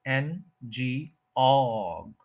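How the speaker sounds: "एन जी ऑग" very slow, in separate syllables. Shown as a demonstration. Teacher breaking down the word, slow separated syllables. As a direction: draw out "ऑग" as a long stressed OG. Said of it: "third के ऊपर stress है".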